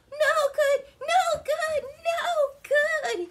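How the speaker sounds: very high, loud repeated wail; calling out. A narrator's reading. Bouncing Ricky calls to the rocks, high loud cries. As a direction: call it out in bouncing bursts. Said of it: "he called".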